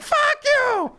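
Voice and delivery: very high mock shriek; imitated screaming. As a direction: screech it in mimicked female fury. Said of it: "Starts screaming at him".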